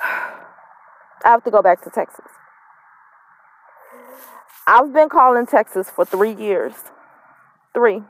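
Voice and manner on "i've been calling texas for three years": usual speaking voice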